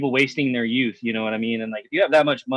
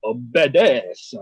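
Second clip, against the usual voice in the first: mock Italian accent; 4 semitones lower, much slower than usual. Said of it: "that's Italian".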